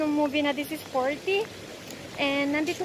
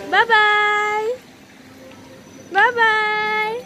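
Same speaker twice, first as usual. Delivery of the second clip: higher, loud sing-song bye-bye; calling bye-bye; 6 semitones higher, 8 dB louder, much slower than usual. Real, not acted. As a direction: wave her off from afar. Waving to child on ride.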